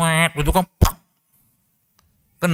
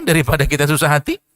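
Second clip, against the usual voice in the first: ordinary talk, nothing marked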